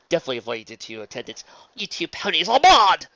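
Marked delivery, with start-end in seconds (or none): 1.7-3.1 high, loud accusation; outraged shout. Acted. Luna dodging the two attendants, shouting indignantly. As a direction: yell it at both attackers.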